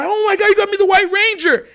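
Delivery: high, overjoyed squeal of words; thrilled kid. Retold or imitated. Kid thanking dad for toy, high-pitched excitement. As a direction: gush like a kid unwrapping a dream toy.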